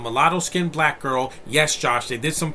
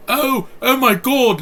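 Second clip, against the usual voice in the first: shrill, slow, much higher and louder mock British gasp; mocking British accent; 13 semitones higher, 10 dB louder, slower than usual. Reviewer mocking the actor's Harry Potter style British accent, loud and high. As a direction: ham up a prissy British schoolboy. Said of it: "a British accent and sounds like a Harry Potter character".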